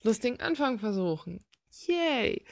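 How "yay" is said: mock cheer. Mock cheer after joke opening.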